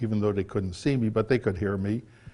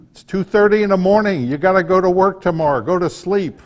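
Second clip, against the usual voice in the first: high, loud, fast retold yell; wife yelling; 9 semitones higher, 8 dB louder than usual. Retelling his wife yelling at him to sleep, loud high shout. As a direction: bellow it like a wife calling at 2:30 am. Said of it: "it was my wife yelling".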